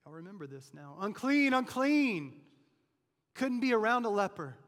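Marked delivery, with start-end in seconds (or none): 1.0-2.4 loud, high call of a leper; leper's cry. Acted. The leper warns people away, loud high cry. 3.3-4.6 full-force preaching. Acted.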